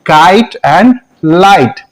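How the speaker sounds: kite, light with a full "eye" vowel; long I. Shown as a demonstration. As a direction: say the letter's name inside kite and light. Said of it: "long sound में आ जाएगा". (लंबा आई)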